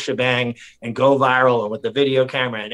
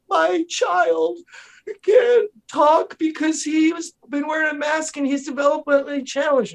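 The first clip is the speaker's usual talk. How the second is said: very high, mock-distressed parent voice; pleading parent impression. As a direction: imitate a parent pleading at a meeting. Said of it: "People like".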